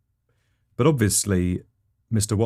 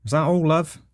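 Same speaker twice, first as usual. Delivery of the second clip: higher-pitched till lady; 8 semitones higher than usual. Acted. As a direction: put on a higher voice for the shop assistant. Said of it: "the lady at the till says".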